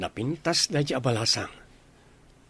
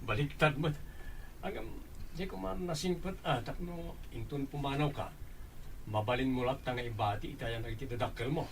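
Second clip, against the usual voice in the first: hushed teasing; 10 dB quieter than usual. Acted. Hushed teasing between friends.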